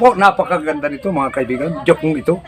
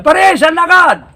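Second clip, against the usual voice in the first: higher, slow greeting to a buddy; friend calling out; 10 semitones higher, 5 dB louder, much slower than usual. Acted. Barkada calling out to his friend in the street, high and drawn out. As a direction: hail a pal passing by.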